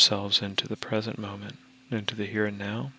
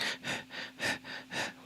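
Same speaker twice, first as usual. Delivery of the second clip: quick, soft little puffs of breath; short shallow breaths; 12 dB quieter than usual. Shown as a demonstration. Meditation guide shows stressed breathing, short shallow breaths. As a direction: pant lightly in short separate bursts. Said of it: "shallow breaths like".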